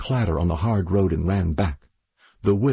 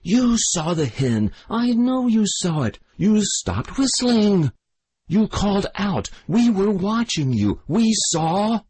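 furious accusing; 12 semitones higher than usual. A narrator's reading. The widow accuses the boy while beating him, furious. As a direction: hurl each charge between blows. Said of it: "gasping between the blows".

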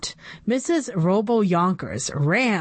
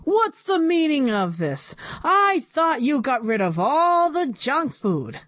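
raised, higher angry demand; 7 semitones higher than usual. A narrator's reading. Board president scolds the principal, higher angry demand. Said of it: "yelled Doctor Carbles".

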